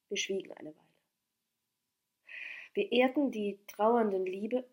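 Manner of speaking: usual speaking voice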